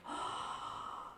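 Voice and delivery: put-on breathy, laughing wonder of a small boy; amazed little boy. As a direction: whisper it, then laugh in delight.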